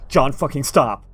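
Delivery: shouting. Acted. Sudden outburst at him.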